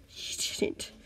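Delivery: breathless, nervous hush; nervous whisper. A narrator's reading. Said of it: "Green said nervously".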